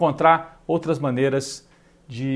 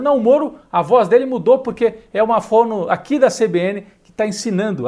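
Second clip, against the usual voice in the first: higher-pitched retelling of a radio reporter; quoted reporter, raised pitch; imitating a reporter; 6 semitones higher, 4 dB louder than usual. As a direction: lightly act out a reporter's live revelation, pitched up. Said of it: "por uma repórter da C B N, dizendo".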